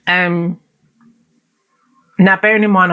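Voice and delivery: normal talking voice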